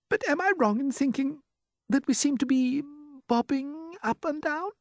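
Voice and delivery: very high, timid question; uneasy ladybird. A narrator's reading. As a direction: ask it going pale. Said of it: "turning a trifle pale".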